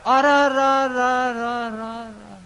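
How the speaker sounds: a drawn-out, high cry of dismay; slow, high wail of shock. Acted. Farmer crying out in dismay to the guru.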